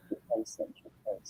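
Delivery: very soft, barely voiced; hushed note to self; muttering to self. Real, not acted. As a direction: repeat it under your breath. Repeating place name to self.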